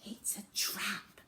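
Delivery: urgent whispered warning. Acted. Zoe warning her dad under her breath, whispered. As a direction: breathe it, afraid to be heard. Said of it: "whispered Zoe".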